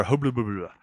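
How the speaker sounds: "hubba, bubba" blubbered as pure mush; toothless babble. Shown as a demonstration. Mimicking how the bouncer spoke, "hubba, bubba" as toothless gibberish. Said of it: "That's the way he spoke".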